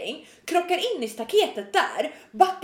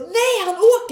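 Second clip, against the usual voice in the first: alarmed cry; 7 semitones higher, 6 dB louder, slower than usual. Retold or imitated. Alarmed report as man flees.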